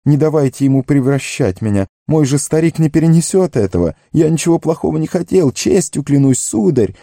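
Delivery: high, panicky pleading; squealing gardener. A narrator's reading. Sam begs Frodo to protect him, squealing high. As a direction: beg your master to save you. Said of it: "заверещал Сэм".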